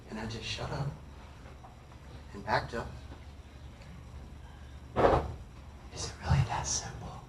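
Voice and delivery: very soft, low, long pauses; hushed awe. Retold or imitated. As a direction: let it out nearly in a whisper. Hushed awe at spiritual realization.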